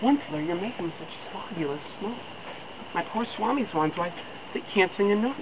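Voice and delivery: the Lorax complaining of the smog with a croak; croaky Lorax. A narrator's reading. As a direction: croak it like smoke is in the throat. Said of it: "with a crepulous croak".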